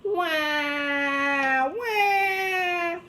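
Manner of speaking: put-on high baby wail; baby screaming wah. A narrator's reading. Baby screams at the principal, wailing. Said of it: "It screamed really loud".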